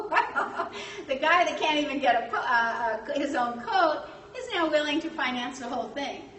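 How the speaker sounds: laughing, incredulous crowd member; mock laughter. Crowd reacting to a poor man offering to finance everything, mock laughter. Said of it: "everybody starts laughing".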